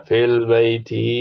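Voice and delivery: fil baiti, slow, with the final ti of baiti stressed; slow down and lean on the ti of baiti; ending stressed. Shown as a demonstration. Teacher shows students the ending change, slowly. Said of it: "kenapa berubah jadi ti".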